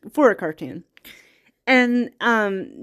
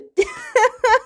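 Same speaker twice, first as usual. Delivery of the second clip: teary laugh; 13 semitones higher than usual. Real, not acted. Moved by the film's message.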